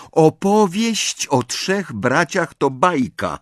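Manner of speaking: slow, high-pitched, emphatic statement; firm declaration. A narrator's reading. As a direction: state it firmly and deliberately. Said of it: "oświadczyła stanowczo Hermiona". (stanowcze oświadczenie)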